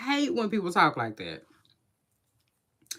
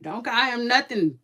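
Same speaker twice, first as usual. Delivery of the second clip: imitating own scolding; 5 semitones higher, 5 dB louder than usual. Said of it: "And I be like".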